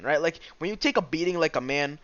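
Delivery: ordinary talk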